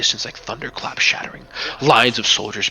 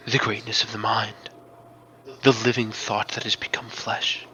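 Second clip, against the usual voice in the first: a grave priestess asking about his faith; the mystic Meningian testing the hero, hushed and grave; solemn mystic; 4 dB quieter than usual. Acted. GM voices Meningian's speech.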